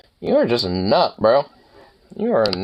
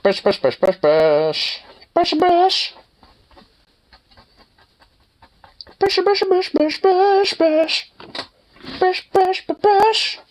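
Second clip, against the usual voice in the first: sing-song brushing noises; slower than usual. Real, not acted. Brushing the dog, sound effect.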